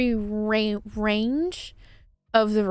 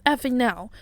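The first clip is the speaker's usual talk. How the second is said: higher, urgent re-enacted pleading to wake up; retold panic; 5 semitones higher than usual. Woman begging sleeping partner for light, in retold panic. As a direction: act out frantic, repeated demands, pitched up. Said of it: "anybody who would be panicking that much".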